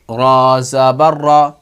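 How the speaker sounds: very slow, drawn-out "रा ज़बर रा"; Qaida spelling demo. Shown as a demonstration. Teacher modelling the zabar sound, very slow "रा ज़बर रा". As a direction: stretch "रा ज़बर", keeping the same R sound in the final "रा". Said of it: "आवाज़ वही निकालनी है".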